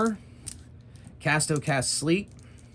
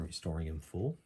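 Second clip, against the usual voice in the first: ordinary delivery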